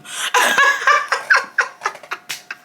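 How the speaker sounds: long, high helpless laugh; real laughter. Real, not acted. Cracking up after admitting she cursed him, laughing. Cracks up at her own confession.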